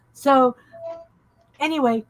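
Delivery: ordinary delivery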